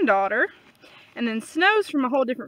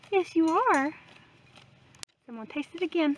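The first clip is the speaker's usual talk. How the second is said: ordinary delivery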